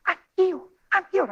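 high, childlike imitation of excitement; mimicked giddy high outcry; like a little kid. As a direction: squeal it like a thrilled kid tugging at someone. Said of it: "she's like a little kid".

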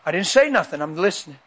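ordinary talk, nothing marked

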